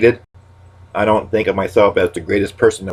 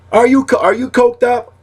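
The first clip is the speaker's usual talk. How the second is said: much higher, louder teasing jab; pitched-up comic retort; high mock accusation; 4 dB louder than usual. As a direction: needle them in a squeaky voice. Catchphrase fired at a friend.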